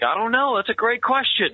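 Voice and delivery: high, put-on breezy voice; mock clueless reply. Captain dodging his sergeant's hard question, high mock-clueless voice. Acting out a reply he cannot give.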